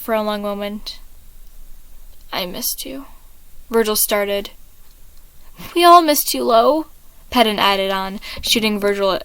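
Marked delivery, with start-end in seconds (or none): 2.3-3.1 much softer, tender admission; soft confession. Acted. Virgil's quiet confession. 5.7-6.9 higher, louder loving outburst; heartfelt burst. Acted. Patton's emotional interruption.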